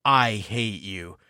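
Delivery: low, slow, hushed "I hate you"; mock quiet loathing; cold retold hatred. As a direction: drop the voice and say it coldly. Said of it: "and then says".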